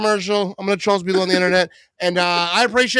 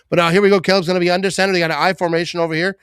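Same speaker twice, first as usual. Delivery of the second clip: louder, quicker announcer call; play-by-play call, picking up; 7 dB louder, faster than usual. Acted. Fan calls the fourth-down play, rising excitement. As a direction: build the snap like a live broadcaster.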